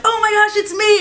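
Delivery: high, excited cartoon boy voice; imitating Steven's voice. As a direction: pitch way up like a peppy cartoon kid. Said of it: "he did it in his Steven voice".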